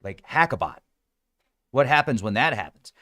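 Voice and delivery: normal talking voice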